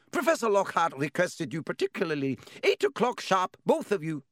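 high, strict schoolmistress voice; stern professor. A narrator's reading. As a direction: hand out the order with no room to argue. Narrator voices McGonagall.

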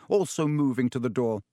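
ordinary talk

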